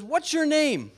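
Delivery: high, loud acted question; burglar questioning parrot. Burglar demanding a parrot's name, high and loud. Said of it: "he looks at that parrot and he says".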